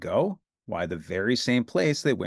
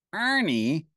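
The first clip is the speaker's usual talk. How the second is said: Bert voice; 6 semitones higher, slower than usual. A narrator's reading.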